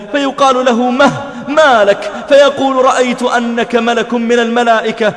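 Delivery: impassioned preaching. Acted. Preacher acting the awestruck man's exchange with the palace keeper, impassioned preaching. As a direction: preach the exchange with urgent intensity. Preacher dramatizes the awed encounter.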